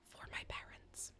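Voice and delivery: soft unvoiced whisper. Real, not acted. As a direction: whisper it secretly. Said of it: "I have to whisper".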